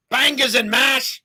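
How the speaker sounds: slow, loud, high mock British accent; hammed-up British accent on Bangers and mash; bad British accent. Host tries a British catchphrase, loud mock British accent. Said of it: "That's a terrible accent".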